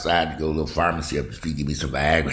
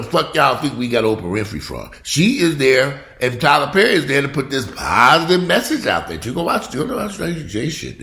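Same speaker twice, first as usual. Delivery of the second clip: louder, higher rant; 8 semitones higher, 5 dB louder than usual. Real, not acted. Ranting at viewers who call him negative, raised voice. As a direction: tell the critics off, loud and high.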